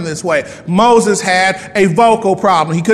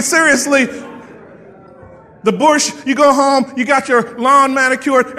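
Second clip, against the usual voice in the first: ordinary talk, nothing marked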